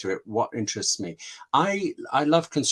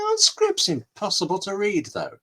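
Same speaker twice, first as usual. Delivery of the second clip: higher-pitched mock complaint; mock sceptical friend; 8 semitones higher than usual. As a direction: lightly imitate a tipsy friend's doubting question. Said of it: "And started saying".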